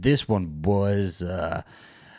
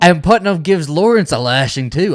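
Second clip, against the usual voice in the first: louder and higher, animated; excited; 9 semitones higher, 10 dB louder than usual. Real, not acted. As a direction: tell it with rising excitement. Excited retelling of the scene.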